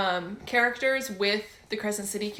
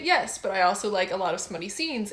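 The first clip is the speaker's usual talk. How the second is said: ordinary talk, nothing marked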